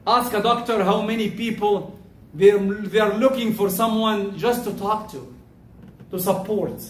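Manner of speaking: ordinary talk, nothing marked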